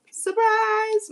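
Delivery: high, loud sing-song surprise; spring it on them like a party reveal; shouted triumphant surprise; gleeful exclaim. Real, not acted. Card flips out, reader exclaims.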